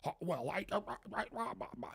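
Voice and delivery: fading, breathy mock stammer; imitating him lost for words. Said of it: "And he's like".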